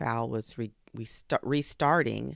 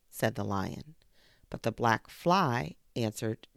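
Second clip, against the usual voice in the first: plain reading voice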